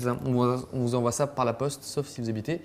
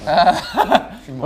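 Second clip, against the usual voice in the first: laughing; 6 dB louder, much slower than usual. Real, not acted. Laughing at teammate's move.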